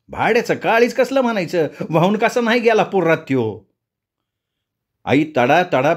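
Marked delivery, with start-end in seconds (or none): 0.1-3.6 mild, higher-pitched indignant cursing; cursing mother. A narrator's reading. Mother cursing the thief who braved the flood, indignant. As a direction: curse him in a raised pitch.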